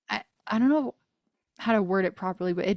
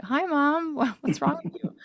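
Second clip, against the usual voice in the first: put-on kids' voice; 7 semitones higher than usual. Kids quoted greeting her.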